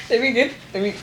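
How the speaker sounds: usual speaking voice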